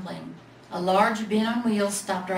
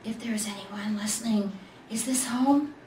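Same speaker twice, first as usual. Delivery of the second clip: whispered. A narrator's reading. Little bear whispers in the dark room. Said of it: "he whispered".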